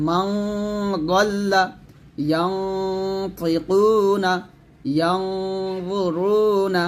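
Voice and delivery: heavy held hum on মাং and ইয়াং; thick ghunnah. Shown as a demonstration. Teacher reciting examples of thick sound, heavy nasal on মাং, ইয়াং. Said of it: "এটা হলো মোটা আওয়াজ".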